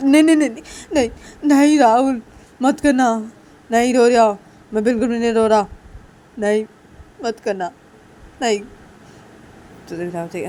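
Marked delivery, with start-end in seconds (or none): 0.1-8.6 loud, high rush of refusals; acted frantic protest; panicked pleading. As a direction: beg urgently to stop the call. Soti begs Rahul not to call.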